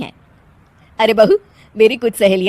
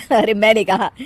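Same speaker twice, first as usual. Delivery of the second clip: usual speaking voice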